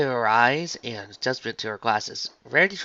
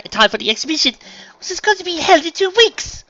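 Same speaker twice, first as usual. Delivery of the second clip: higher, building to loud panic; Rarity, fretting unicorn; 7 semitones higher, 6 dB louder than usual. Acted. Dressmaker explains her tight deadline to friend, mounting fret. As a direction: explain the dilemma, rising toward a squeal.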